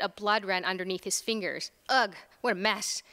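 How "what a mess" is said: plain reading voice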